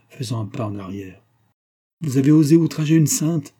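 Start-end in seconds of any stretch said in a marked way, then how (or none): 2.0-3.6 loud, higher accusation; outraged anger. A narrator's reading. Husband defends a saint against his wife, outraged. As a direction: accuse her in rising outrage. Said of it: "Sa colère était si forte".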